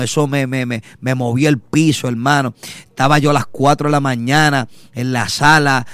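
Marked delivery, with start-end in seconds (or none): none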